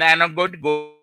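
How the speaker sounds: ordinary talk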